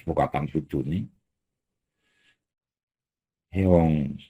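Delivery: low, soft and tender, unhurried; very gentle. Acted. Allah speaks to the sinner, very gentle and soft. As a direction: soothe a guilty sinner softly, as a forgiving voice. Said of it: "Ngomongnya halus pisan".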